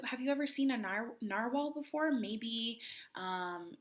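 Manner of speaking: ordinary delivery, nothing marked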